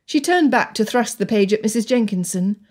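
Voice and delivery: ordinary delivery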